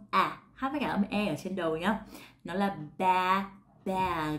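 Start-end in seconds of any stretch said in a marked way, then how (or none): 2.4-4.4 "ba, bag" with a wide open a and a voiced g ending; "bag" as /bæg/, open a, final g; slow bag demo. Shown as a demonstration. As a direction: slowly build "ba" into "bag" with a wide mouth. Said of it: "miệng của mình nó sẽ rộng hơn".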